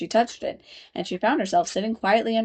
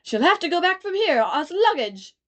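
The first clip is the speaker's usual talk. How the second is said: higher beetle voice; 9 semitones higher, 3 dB louder than usual. A narrator's reading. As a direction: squeak it as a beetle. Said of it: "he went on with".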